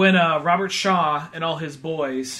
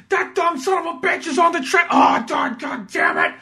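high, loud shouting New Yorker; hammed-up New York fury; mock angry yell; 11 semitones higher, 4 dB louder than usual. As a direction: shout it like a fed-up dispatcher. Voicing angry character.

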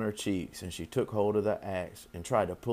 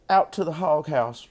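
higher, louder mother's reply; lightly voiced mother, pitched up; Missus Arborough; 6 semitones higher, 6 dB louder than usual. A narrator's reading. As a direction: call it out as the mother. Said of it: "replied Missus Arborough".

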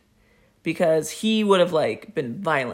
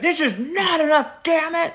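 high-pitched exasperated outburst; high, indignant demand; angry robber; 9 semitones higher than usual. Retold or imitated. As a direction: snap at them like a fed-up robber.

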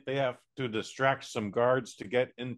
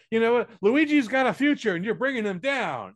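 higher, louder, accusing jab; scolding relative; 8 semitones higher, 6 dB louder than usual. Retold or imitated. Relative scolding Mario over Luigi, loud and higher. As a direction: snap it at him like a fed-up dad. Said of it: "everyone's just like".

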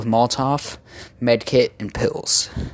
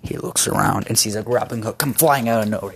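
ordinary talk

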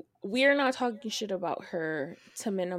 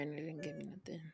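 whisper; 3 semitones lower, 14 dB quieter, much faster than usual. Real, not acted. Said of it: "whispered aside".

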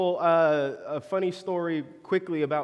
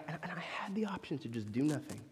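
whispered aside; 3 semitones lower, 10 dB quieter than usual. Real, not acted. Whispered aside in sermon story.

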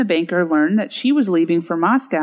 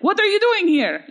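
put-on abrupt, high-pitched Russian-accented demand; lightly put-on Russian accent, pitch jumping up; 14 semitones higher than usual. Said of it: "Was that your Russian accent?".

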